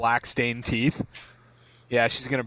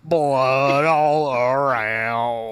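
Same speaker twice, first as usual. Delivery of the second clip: gross-out relish; 3 dB louder, slower than usual. Acted. Gory detail to gross out.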